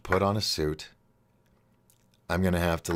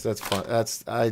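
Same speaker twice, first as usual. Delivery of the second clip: ordinary talk, nothing marked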